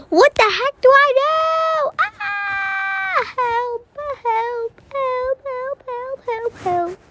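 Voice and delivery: high, far-off panicked calling; mock cry for help. Trapped gamer calls help to viewers, high panic.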